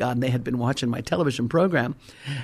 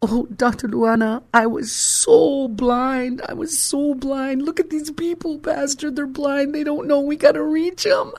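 much higher, urgent voice of an awakened woman; high excited young woman; 12 semitones higher, 3 dB louder than usual. Retold or imitated. Young woman to the preacher about the crowd, high and urgent. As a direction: voice her high and stirred, begging to reach them. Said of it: "she's looking at the crowd and she goes".

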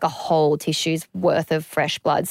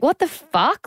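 retold shock; 9 semitones higher, slower than usual. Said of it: "And I'm like".

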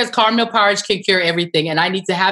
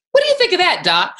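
mock Trump question; 9 semitones higher, 4 dB louder than usual. Mimicking Trump asking the doctor.

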